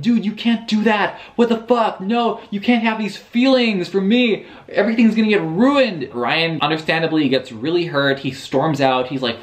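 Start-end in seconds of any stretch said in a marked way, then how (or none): 0.0-6.1 freaking out. Retold or imitated. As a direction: voice shoots up high in a panicked rant. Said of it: "he freaks out".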